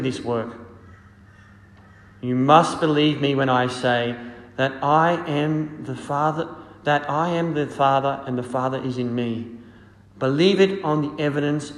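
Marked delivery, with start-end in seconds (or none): none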